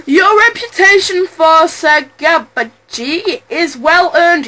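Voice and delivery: usual speaking voice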